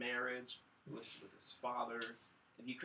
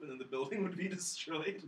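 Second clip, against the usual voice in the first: normal talking voice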